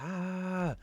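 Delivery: high-pitched mock scream; long high "aah", mimicking a crowd; imitated fan scream. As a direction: imitate screaming fangirls without extra volume. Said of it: "they started screaming".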